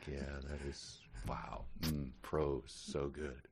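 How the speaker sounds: soft, slow sarcastic admiration; mock hushed awe. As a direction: breathe it like mock reverence. Mock awed reaction.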